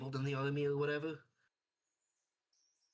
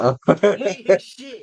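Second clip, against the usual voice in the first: loud mock denial; 3 semitones higher, 22 dB louder, slower than usual. Real, not acted.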